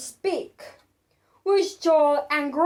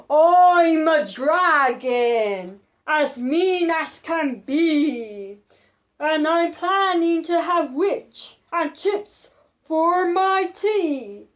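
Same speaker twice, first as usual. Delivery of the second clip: slow, louder growling dragon; dragon roar. Acted. The dragon threatening to eat the witch, slow roar. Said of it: "roar that was scary and loud".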